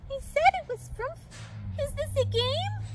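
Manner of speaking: high, piping question; little character voice. A narrator's reading. Onlooker wondering if it's a game, high voice. Another small character chimes in.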